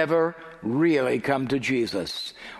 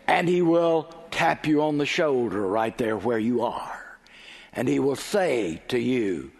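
usual speaking voice

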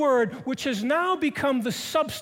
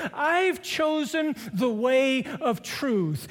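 slow emphatic refrain; 2 semitones higher, much slower than usual. Acted. Sermon refrain, emphatic close.

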